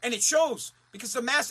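normal talking voice

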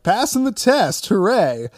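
high, loud mock cheer; mock triumph. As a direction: cheer it like a feel-good movie victory. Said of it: "satisfaction of them".

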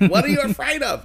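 lilting high Southern voice, stress on you; Leslie Jordan impression. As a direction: ask it like Leslie Jordan would. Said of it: "He sounded like Leslie Jordan".

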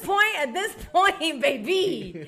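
laughing through a proud, defiant brag, slowed down; giggly, sassy, unapologetic; laughing defiance. Retold or imitated. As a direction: say it proudly while cracking up. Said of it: "And I'm like".

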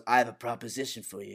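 understated mobster quote; Luca. Retold or imitated. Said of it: "well, Lucas says that".

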